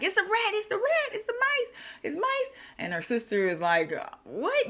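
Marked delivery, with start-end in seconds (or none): 0.0-2.5 very high, fast mock panic; panicked yelling. Sister jumps up at the mice, high yelling. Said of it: "her sister's yelling". 4.2-4.7 high startled screech; mock screaming. Sister reacts to the milliner's scare, mock screaming. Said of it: "You know, screaming".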